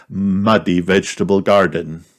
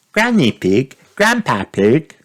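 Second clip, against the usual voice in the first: higher, eager greeting; Peppa calling out happily; 6 semitones higher than usual. A narrator's reading. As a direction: call to the grandparents with delight. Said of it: "cried Peppa".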